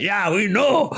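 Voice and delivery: put-on retelling the dad's reply in a playful Indian accent; imitating his dad's Indian accent. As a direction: quote the dad in a mock Indian accent. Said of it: "with a big old accent".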